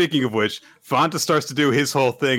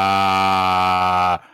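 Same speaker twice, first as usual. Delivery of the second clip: low, held monotone aaah; long droning "ah"; 7 semitones lower than usual. Acted. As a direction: stall on one low drawn-out note. Fanta's long blank reply.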